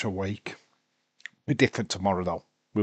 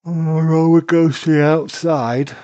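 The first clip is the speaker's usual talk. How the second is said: much louder and higher burst of excitement; excited commentary; 7 semitones higher, 12 dB louder than usual. Real, not acted. Fan calling the match to viewers, excited and loud. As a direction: call the action like it's huge. Live commentary of wrestling action.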